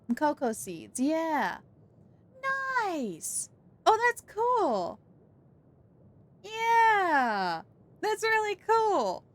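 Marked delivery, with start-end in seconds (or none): 3.9-9.2 much higher, slow and drawn-out delight; genuinely thrilled, stretch it out. Real, not acted. Delighted by chat's explanation.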